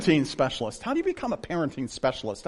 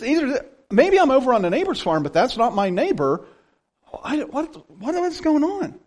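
high-pitched, acted-out confusion; bewildered kid; 9 semitones higher, 3 dB louder than usual. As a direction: puzzle it out in a rising, baffled voice. Acts out confused boy questioning himself.

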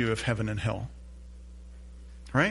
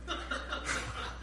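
silent, breathy laugh; a soft rueful chuckle; breathless laugh; 11 semitones higher, 13 dB quieter than usual. Real, not acted. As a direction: laugh at yourself under your breath. Laughing at his own mistake.

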